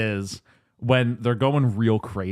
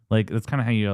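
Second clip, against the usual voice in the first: ordinary talk, nothing marked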